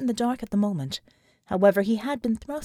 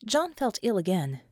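ordinary delivery, nothing marked

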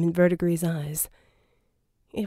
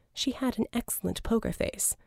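plain reading voice

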